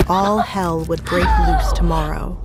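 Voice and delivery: ordinary talk, nothing marked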